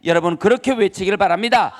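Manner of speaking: ordinary talk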